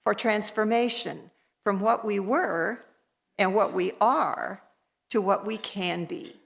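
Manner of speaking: measured, solemn invocation read to the congregation; call to worship. Acted. Minister calling the congregation into worship, solemn invocation. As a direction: lift the words as a liturgical opening, unhurried.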